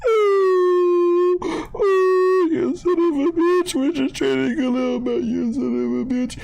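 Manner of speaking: high, whiny fake sobbing curses; mock crying rant. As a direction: wail it out like a heartbroken fan. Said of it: "I was at work crying".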